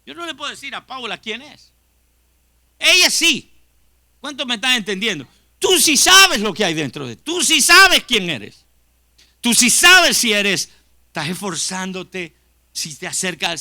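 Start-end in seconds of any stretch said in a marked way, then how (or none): 2.8-10.7 forceful pulpit insistence; emphatic preaching. Acted. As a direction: drive it home to the congregation. Preacher presses point to congregation.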